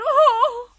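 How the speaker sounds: high, loud outcry; sharp cry. A narrator's reading. Queen crying out as the pin opens, pained. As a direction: cry "oh" as if pricked. Queen screams about coming pinprick.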